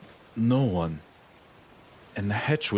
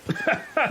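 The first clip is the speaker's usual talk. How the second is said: scoffing laugh. Acted. Captain scoffs at ghost story.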